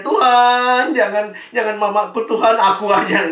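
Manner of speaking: loud, high mock wail; imitating a crying child. Son begging God to spare his mother, loud high plea. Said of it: "nangis lagi anaknya".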